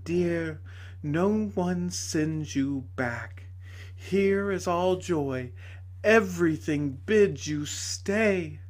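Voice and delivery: tender pleading. A narrator's reading. Lady gently begging the shrinking dwarf to stay. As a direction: coax him gently to stay.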